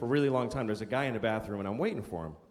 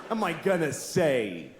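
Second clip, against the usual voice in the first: ordinary delivery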